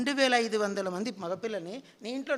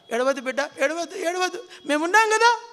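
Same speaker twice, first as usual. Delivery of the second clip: soothing, then rising higher and louder on the last words; comforting a crying child; 5 semitones higher, 5 dB louder than usual. Acted. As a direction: hush the child, then swell up big with reassurance. (ఏడుస్తున్న బిడ్డను ఓదారుస్తూ, చివర్లో గొంతు పైకి లేపి)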